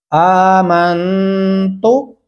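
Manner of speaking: आमन तो with aa held long, तो short; slow model reading of आमन तो; long vowel. Shown as a demonstration. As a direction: stretch आमन slowly, then a short तो. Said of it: "उसको लंबा करेंगे".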